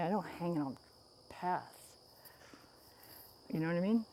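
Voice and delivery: normal talking voice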